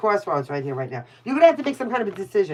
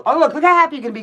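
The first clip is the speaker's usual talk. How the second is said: excited delight; 7 semitones higher, 5 dB louder, much faster than usual. Real, not acted. Delighted by the cards.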